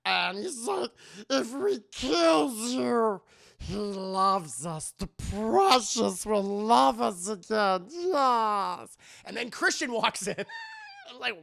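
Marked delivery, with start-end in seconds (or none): 1.3-9.0 grovel like Gollum, dragging the words; slow, pitched-up Gollum imitation.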